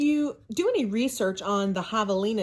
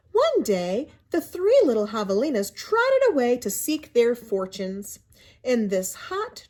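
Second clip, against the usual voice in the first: storybook narration; 5 semitones higher than usual. A narrator's reading. Teacher reading a picture book to her class, in lively storybook narration.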